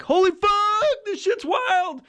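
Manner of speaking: high, slow, stunned "holy fuck"; awed exclamation. Retold or imitated. Stoner watching a cosmic spectacle, awestruck high exclamation. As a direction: gasp it out like you can't believe your eyes. Awed reaction in a wild vision.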